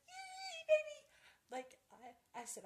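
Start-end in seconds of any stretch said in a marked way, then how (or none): 0.1-1.0 high excited squeal, a bit louder; reenacted happy squeal; squealing yay again. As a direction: shriek it with pure glee. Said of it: "And, like".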